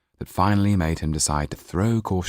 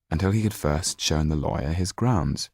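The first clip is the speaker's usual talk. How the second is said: ordinary delivery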